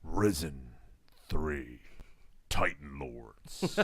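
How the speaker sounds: low, slow, dramatic trailer narration; movie trailer voice. Pretend. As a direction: announce it like an epic trailer. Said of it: "your movie, uh, trailer voice".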